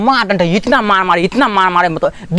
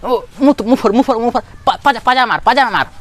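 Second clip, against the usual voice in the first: cheering on fight; 8 semitones higher than usual. Retold or imitated. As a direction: shout like kids cheering a brawl, much higher. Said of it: "वो शांति से देख रहे थे ऐसे करके". (दोस्तों की ऊँची जोशीली ललकार)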